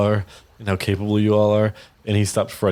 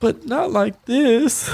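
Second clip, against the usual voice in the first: high, slow plaintive whine; Shinowis pleading; much slower than usual. Acted. Shinowis pleading with Castellan, slow high plaintive voice. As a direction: drag it out pleading. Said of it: "Shinowis is like".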